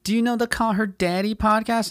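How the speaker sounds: high-pitched, eager mock question; mocked podcast fans. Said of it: "And they're like".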